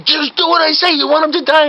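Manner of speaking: high-pitched, urgent mock scolding; frantic insistence. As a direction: snap at a hesitant helper, hammed-up. Fake doctor scolds hesitant helper.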